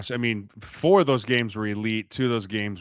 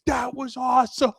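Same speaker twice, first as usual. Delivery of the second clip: much higher, louder cheer; 14 semitones higher, 5 dB louder than usual. Retold or imitated. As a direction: cheer like a kid. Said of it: "stand up and cheer".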